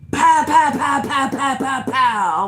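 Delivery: loud, rapid high-pitched pows; imitated banging. Neighbour banging on the screen door, imitated loud pounding. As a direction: pound out each pow like a fist on a door. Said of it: "banging on my door like this".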